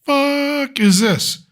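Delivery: slow, high what-the-heck; baffled. Retold or imitated. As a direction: stretch it out in disbelief. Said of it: "I was like".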